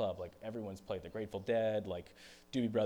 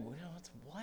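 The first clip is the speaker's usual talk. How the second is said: higher, softer confused question; puzzled asking back; 7 semitones higher, 10 dB quieter than usual. Retold or imitated. Musician who misheard girls over the band, puzzled question. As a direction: strain to catch the missing word.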